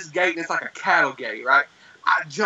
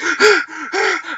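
high acted panic gasp; hyperventilating gasp; 11 semitones higher, 4 dB louder than usual. Caller panicking to nine one one, mock hyperventilating gasp. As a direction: suck in air as if hyperventilating. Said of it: "like hyperventilating".

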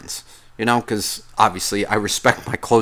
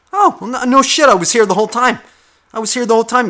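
indignant retort; 12 semitones higher, 7 dB louder, faster than usual. Retold or imitated. Retorting to partner in retold scene.

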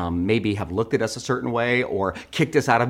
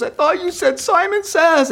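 high whiny half-laughing wail; mock sob like an upset child; imitating crying kid. Little boy crying he's out of the game, mock whine.

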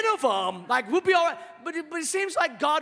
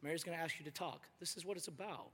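quiet, confiding God; 9 semitones lower, 16 dB quieter, faster than usual. Retold or imitated. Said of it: "I would have been like".

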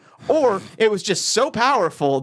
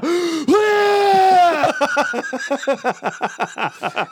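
gasping laugh; 10 semitones higher than usual. Real, not acted. Remembering puking out the window.

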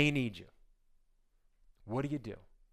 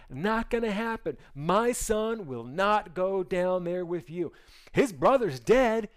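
angry father Jacob; 4 semitones higher than usual. Acted. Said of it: "he goes".

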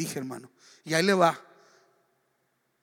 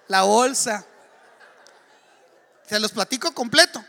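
laughing through the words, louder; 4 semitones higher, 10 dB louder than usual. Real, not acted. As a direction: chuckle as you tease the story. Preacher laughs telling the gossip.

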